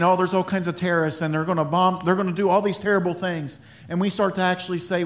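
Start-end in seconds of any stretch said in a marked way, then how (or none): 0.0-3.6 fast, rattled-off worry; mock alarm. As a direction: rush through the fears. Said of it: "and saying".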